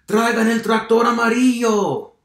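high, very loud call; booming, high-pitched farmer's holler; farmer shouting. A narrator's reading. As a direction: yell for help across the farm. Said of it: "gritó el granjero".

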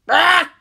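sharp high yelp of alarm; a startled shout bursts out; loud startled Ah; sudden cry. Acted. Sudden cry as penguin appears.

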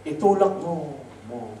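itulak mo said with a mock echo repeating mo; a voice from the sky that echoes its last word. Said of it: "May echo pa yung pagkakasama yun". (itulak mo na may alingawngaw, inuulit ang mo)

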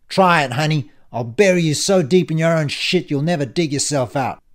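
loud, menacing bully; threatening villain. Acted. Ian threatens Justine down the phone, loud and menacing. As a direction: bear down hard on the threat.

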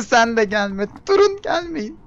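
high, loud laughing squeal; laugh-shriek at the knives to stay away; giggling panicked shriek. Real, not acted. Laughing as enemies chase her.

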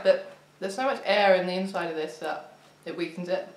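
chewing while talking; crunching snack between words; mouth full of crisps. Real, not acted. Seen 0: Sniffs bag first, then eats crisps from bag while talking.

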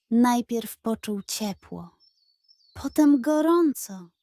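ordinary delivery, nothing marked

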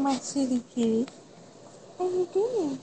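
usual speaking voice

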